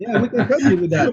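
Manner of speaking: amused, teasing through laughter; laughing as he offers help. Real, not acted.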